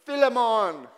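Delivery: wife's mock Jamaican accent, loud and high. Pastor imitating his wife around the house, in a playful Jamaican accent. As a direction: belt the name out in a put-on Jamaican accent. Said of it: "in a Jamaican accent saying".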